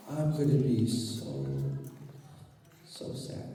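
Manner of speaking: soft, low, fading to a breath; so sad. Shown as a demonstration. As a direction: let the sadness sink to a whisper. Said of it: "Are you feeling sad with me".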